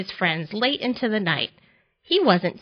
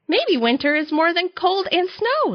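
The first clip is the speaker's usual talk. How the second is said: higher-pitched, happy realisation; delighted; 9 semitones higher than usual. A narrator's reading. Tortoise flying off his sled, thinking it delightedly. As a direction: lift the voice with delight.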